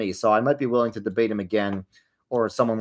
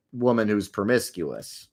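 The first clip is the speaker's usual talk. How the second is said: ordinary talk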